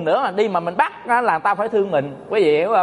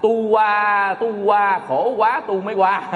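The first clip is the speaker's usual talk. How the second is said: drawn-out mock newborn wail; imitated baby cry; slower than usual. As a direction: wail the words like a crying baby, in fun. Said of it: "ông la". (giả tiếng trẻ khóc cho vui)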